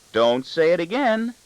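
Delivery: high, slow motherly warning; exasperated but amused reproach; mock scolding. A narrator's reading. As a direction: warn him playfully, drawn out high.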